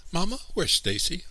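put-on very high, anxious child's voice; high-pitched, worried question; child crying out. A narrator's reading. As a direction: call to mother in a high child's cry. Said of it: "I cried".

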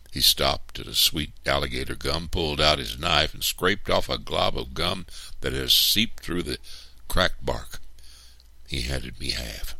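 ordinary delivery, nothing marked

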